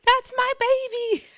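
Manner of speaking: very high, squealed proud-mom cheer. Retold or imitated. Imitating Ivory cheering D T B on, squealing proud mom. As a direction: squeal it slowly like the biggest cheerleader. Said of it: "acting like such a mom".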